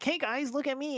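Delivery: high, airy attention-seeking call; attention seeker. Retold or imitated. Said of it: "stand out in class and be like".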